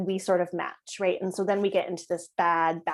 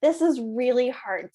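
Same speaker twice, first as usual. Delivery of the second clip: put-on frustrated child; 4 semitones higher, 4 dB louder, slower than usual. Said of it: "it's like".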